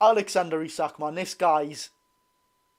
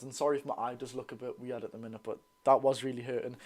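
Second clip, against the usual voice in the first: usual speaking voice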